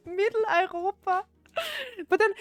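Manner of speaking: high, laughing repeat. Real, not acted. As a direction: crack up while saying it. Cracks up repeating the dialect word.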